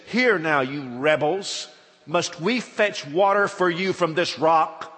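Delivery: loud, slow furious rebuke; angry rebuke. Acted. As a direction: lash out at the rebels. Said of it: "he says".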